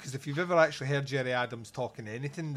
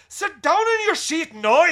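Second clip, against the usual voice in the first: much higher and louder slow Northern Irish yell; yelling Northern Irish teacher; 12 dB louder, slower than usual. Retold or imitated. Teacher silencing a noisy class, slow full Northern Irish yell. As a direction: slam the desk and roar it in a Northern Irish accent. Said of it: "she'd go".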